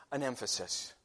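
usual speaking voice

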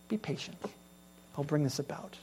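God speaking softly. Acted. Preacher voicing God's hushed answer to the struggling. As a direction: speak it hushed as a calming promise.

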